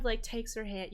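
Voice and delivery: usual speaking voice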